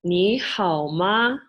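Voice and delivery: "Ni hao ma?" said slowly in Mandarin; slow Mandarin demo. Shown as a demonstration. Teaching how to ask how someone is, slow Mandarin. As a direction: spell out "Ni hao ma?" slowly for learners. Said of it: "you would say".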